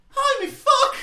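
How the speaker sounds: very high loud panicked shout; terrified yell. Acted. Philippe seeing the monster, very high loud scream. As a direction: scream it in sheer fright. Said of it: "is like".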